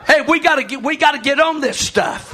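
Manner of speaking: loud, high pulpit call; rally the church; preaching. Acted. Pastor urging the congregation, preaching loud.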